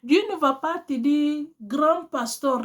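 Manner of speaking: ordinary talk, nothing marked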